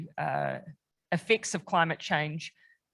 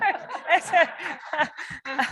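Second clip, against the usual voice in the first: real laugh; 9 semitones higher than usual. Real, not acted. Prime Minister laughs at the repeated nomination, a real laugh. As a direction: laugh warmly at the flattering offer.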